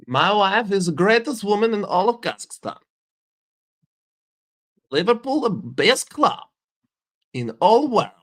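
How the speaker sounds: proud Kazakh reporter boasting; mock Kazakh accent. Boasting about his wife and Liverpool, in a hammed-up Kazakh accent.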